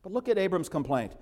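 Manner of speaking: ordinary talk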